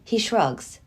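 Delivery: usual speaking voice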